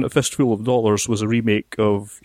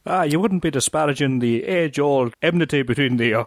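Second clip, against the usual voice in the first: put-on stage Irish lilt, a touch higher; Irish accent; 4 semitones higher than usual. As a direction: play it as a cliched Irish accent. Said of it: "that sort of cliched Irish accent".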